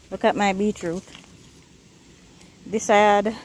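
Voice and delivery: ordinary talk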